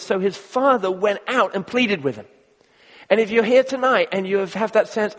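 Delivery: loud, pressing sermon voice; preaching. Acted. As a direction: push the point with full force. Preaching on the older brother.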